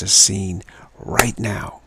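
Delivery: "right now" slow and leaned on; stress on right now. Shown as a demonstration. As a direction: land firmly on "right now". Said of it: "an emphasis on right now".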